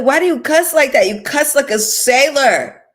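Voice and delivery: high, loud scolding mom voice; mother scolding her cursing daughter, high and loud. Retold or imitated. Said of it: "she'd be like".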